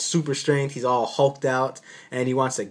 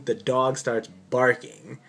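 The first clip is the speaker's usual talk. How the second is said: usual speaking voice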